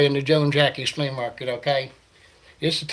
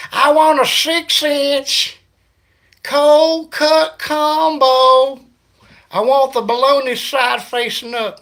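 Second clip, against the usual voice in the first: high, loud, very slow twangy drawl; mock hillbilly ordering through the speaker; 10 semitones higher, 7 dB louder, slower than usual. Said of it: "redneck guy at the Seffner subway".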